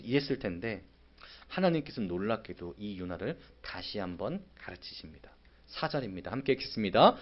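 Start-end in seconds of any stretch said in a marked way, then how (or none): none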